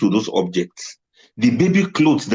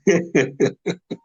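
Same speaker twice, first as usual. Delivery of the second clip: higher-pitched giggle; real laughter; 4 semitones higher, 3 dB quieter than usual. Real, not acted. Still tickled by the old wedding dresses, giggling. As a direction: keep chuckling, unable to stop. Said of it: "This is quite funny to me".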